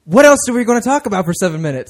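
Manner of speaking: loud exclaim. Real, not acted. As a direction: throw it out loudly. Host exclaims at the time left.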